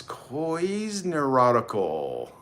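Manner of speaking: "Coy's neurotical" drawn right out; sounding out. Real, not acted. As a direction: stretch "Coy's neurotical" slowly, like a hard name to pronounce. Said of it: "It's a hard one to pronounce".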